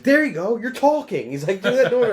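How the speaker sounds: high, loud shouting. Retold or imitated. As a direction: yell it out high.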